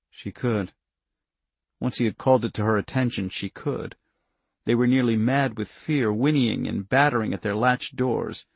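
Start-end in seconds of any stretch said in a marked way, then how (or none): none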